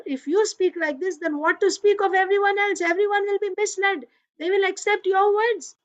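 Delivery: put-on high childish voice, upset and insistent; angry little boy. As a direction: play the five-year-old scolding his father. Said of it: "he was really angry".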